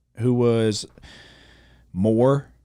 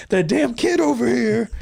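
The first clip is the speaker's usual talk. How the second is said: loud, high, drawn-out grumbling; playful pretend outrage; mock fan rant; 5 dB louder than usual. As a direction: imitate blaming a child for the loss. Joins in mimicking the ranting fan.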